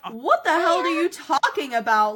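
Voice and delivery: angry husband. Retold or imitated. Husband shouting at his wife over the rodent, outraged. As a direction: blast her with disbelief. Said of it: "And Bob's like".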